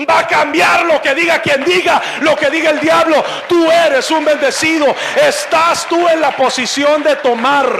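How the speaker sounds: rising pulpit shout; fiery preaching. Acted. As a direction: preach it out full force to the congregation. Preacher building to full force.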